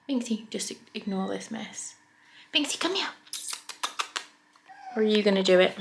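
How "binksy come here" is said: high, bright-pitched cat call; calling a pet. Real, not acted. Owner calling Binksy to come and show a trick, calling. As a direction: coax the cat over in a raised pitch.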